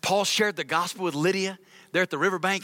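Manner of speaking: usual speaking voice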